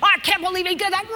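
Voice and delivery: hammed-up indignant outburst; mock outrage. Shown as a demonstration. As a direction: show them how to get worked up. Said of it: "join me, work yourselves up".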